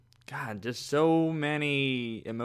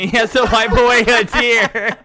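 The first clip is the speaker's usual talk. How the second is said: high, loud, laughing through the words; laughing tease; 8 semitones higher, 11 dB louder than usual. Real, not acted. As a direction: tease them, breaking up with laughter. Laughing while teasing about crying.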